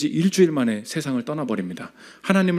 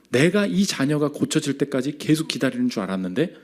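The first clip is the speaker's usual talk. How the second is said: usual speaking voice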